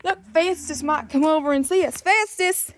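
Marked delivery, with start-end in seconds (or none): 2.0-2.7 calling out. Real, not acted. Calling the dog over.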